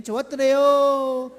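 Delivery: high, slow Gangwon lilt; imitating a long-drawn Gangwon reply; Gangwon dialect. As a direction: draw out the ending in Gangwon style. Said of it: "강원도 쪽에 갔더니".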